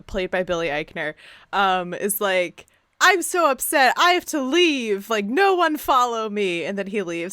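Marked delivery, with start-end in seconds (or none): 3.0-6.6 dramatic mock huff. Imitating Craig's dramatic exit.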